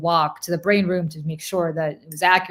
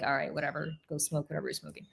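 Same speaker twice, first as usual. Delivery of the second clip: low, quiet brush-off; retold dismissive aside, trailing away; muttered dismissal; 3 semitones lower, 12 dB quieter than usual. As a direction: wave them off under your breath. Retold dismissal of colleague's rant.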